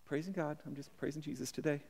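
very soft, low mock praise; calm and reserved. Preacher imitates a reserved churchgoer praising God, hushed and low. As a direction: murmur it with mock restraint. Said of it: "all calm and all reserved".

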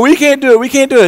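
whiny, defeated complaint; preacher mimicking the complaining crowd; mock grumbling. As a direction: grumble it like a doubter. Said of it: "everybody else was grumbling".

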